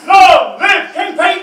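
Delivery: ringing coronation cheer; proclaiming shout. Acted. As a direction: hail the new king. Coronation acclaim.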